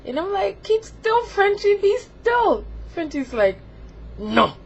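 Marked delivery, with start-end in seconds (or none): 0.6-2.6 very high, pleading reprimand; frantic mock scolding. Begging Frenchie to keep still, high and frantic. 4.2-4.5 curt, louder uppity refusal; a hint of a snooty, demanding figure; Frenchie. Frenchie refusing to behave, uppity. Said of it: "real uppity and snooty".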